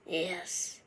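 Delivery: quiet. A narrator's reading. Elf's reluctant admission.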